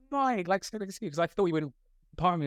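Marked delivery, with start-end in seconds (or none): 0.1-1.1 excited greeting. Retold or imitated. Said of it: "I was like".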